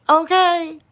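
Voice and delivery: loud, high okay; Unbiased's okay. Retold or imitated. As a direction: belt it out like her. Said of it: "That's her saying too".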